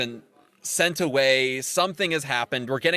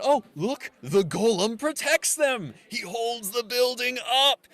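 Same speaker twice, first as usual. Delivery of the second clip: high, slow mock-amazed voice; mock amazed crowd; 9 semitones higher than usual. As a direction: gasp it up high like a dazzled onlooker.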